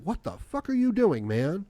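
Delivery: high-pitched incredulous outburst; voiced exasperation, pitch leaping up; exasperated disbelief. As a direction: call him out in disbelief. Voices exasperated reaction to that man.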